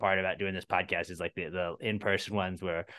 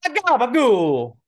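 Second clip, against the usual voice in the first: mock Italian-American accent; 10 dB louder, slower than usual.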